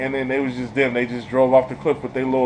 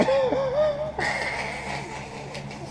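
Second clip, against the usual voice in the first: squealing high laugh; 8 dB quieter than usual. Real, not acted. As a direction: giggle up high.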